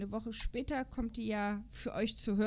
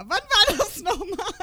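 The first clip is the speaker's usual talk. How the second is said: much higher and louder, playfully eager; mock eager; 9 semitones higher, 11 dB louder than usual. Real, not acted. Pretending to seriously consider the trip, mock eager. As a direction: jump at the offer with playful excitement. Jokingly jumping at the offer.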